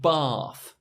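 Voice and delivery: Bath with a long open ah. Shown as a demonstration. Teacher models the upper class Bath. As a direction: say Bath as posh bahth. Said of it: "more upper class, is to the left".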